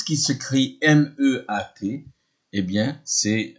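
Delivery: ordinary delivery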